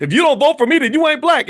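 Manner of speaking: imitating an angry outburst, loud and high; mock blow-up, suddenly yelled in a high pitch. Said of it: "that's when he blew up and said".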